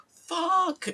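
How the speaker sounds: very high, loud yelp; mock shouted curse. Imitating video makers once the timer passes, yelped curse. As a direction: let the swear burst out, high-pitched. Acting out delayed real swear.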